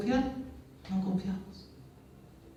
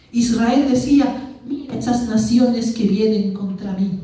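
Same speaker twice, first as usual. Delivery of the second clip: declaimed scripture reading; 5 dB louder than usual. Acted. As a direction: proclaim the verses to the congregation. Reads scripture aloud, declaiming.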